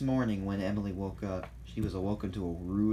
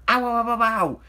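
loud, high, wobbling yell; startled yelp; 13 semitones higher, 12 dB louder than usual. A narrator's reading. Thomas scared by Salty, startled yelping. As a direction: yelp wildly as if jumping in fright. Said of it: "Thomas jumped".

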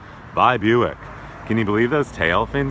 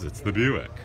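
ordinary delivery

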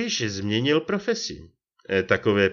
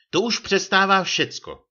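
high, raised, indignant outburst; annoyed; 12 semitones higher, 6 dB louder than usual. A narrator's reading. Lieutenant scolding Čambal for meddling, annoyed. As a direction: voice shoots up in loud protest. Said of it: "zlobil se nadporučík Kříž".

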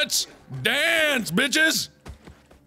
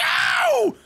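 high shouted "ow"; pained yelp; 12 semitones higher than usual. Real, not acted. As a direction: yelp sharply as if hit. Hit during game fight.

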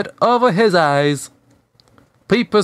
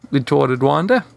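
ordinary delivery

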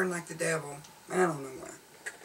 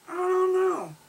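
mock sing-song; 11 semitones higher, 3 dB louder than usual. Exaggerated shrug.

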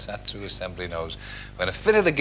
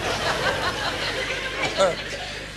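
high giggle. Real, not acted. Narrator's laugh sound.

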